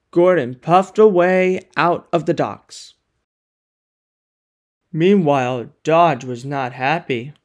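ordinary delivery

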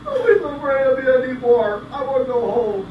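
high, loud blubbering giant; whiny giant. Acted. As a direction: whine like a sulking giant. Giant whines, sad.

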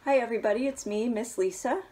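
ordinary delivery, nothing marked

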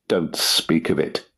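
ordinary delivery, nothing marked

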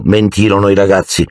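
ordinary delivery

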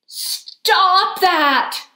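high storybook yell. A narrator's reading. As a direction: yell it crossly like a fed-up child. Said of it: "I yelled".

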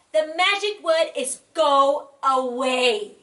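slow, loud, cross mouse; sulky, upset reply. Acted. Mouse upset, rude answer.

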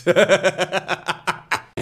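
long staccato laugh; laughter. Real, not acted. Streamer cracking up at the banjo joke, laughing. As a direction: laugh in a steady string of ha-has.

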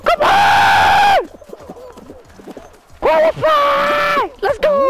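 very high, stretched-out victory scream; ecstatic screaming. Real, not acted. Player just scraping through to qualify, ecstatic screaming. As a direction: scream it at the top of your range.

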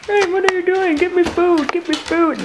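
high, loud begging dog voice; dog's voice. Pretend. Owner voices her dog begging for food, high pushy voice.

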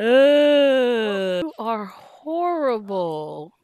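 slow, loud and stretched; drawn-out outraged wail. Real, not acted. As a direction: moan it out in disbelief. Scolding game character.

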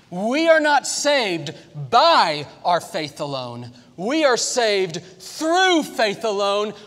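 loud, slow, high preaching climax; preaching at full force. Acted. Preacher to his church, loud and slow at full force. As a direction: pound home each line to the congregation.

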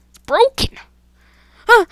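squeaky-high, loud exclamation; high-pitched dismay. Real, not acted. Gamer finds it broken, high loud dismay.

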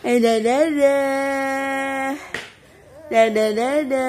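loud, high sing-song bye-bye; loud, high cheerful farewell to viewers; loud goodbye. Acted. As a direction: wave everyone off with big energy.

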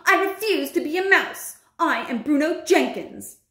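high-pitched indignant declaration; shouted refusal. Acted. Bruno refusing to be a mouse, indignant shout. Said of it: "Bruno shouted, jumping up and down".

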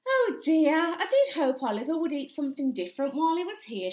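voiced mother's exasperated "oh dear"; dismayed mum. A narrator's reading. Mother dismayed at plate of chips.

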